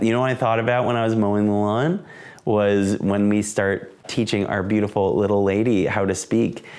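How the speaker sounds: usual speaking voice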